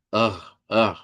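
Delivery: "Ugh" as a throaty uvular rasp; uvular R demo. Shown as a demonstration. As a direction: scrape the back of the throat like a German R. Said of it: "una R uvular". (demostración de la R uvular con la garganta)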